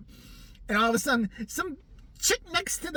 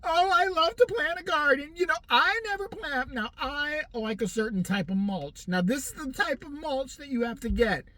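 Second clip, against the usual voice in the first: higher put-on voice; mock gardening lady; 8 semitones higher than usual. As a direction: gush about mulch in a higher voice. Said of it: "Bernice mulch reply".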